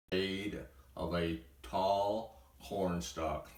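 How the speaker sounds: usual speaking voice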